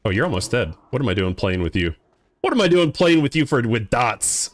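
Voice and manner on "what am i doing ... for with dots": higher, animated outburst that falls away; mock exasperation. Gamer asks himself why he bothers with dots, mock-exasperated. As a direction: start high and punchy, then settle down.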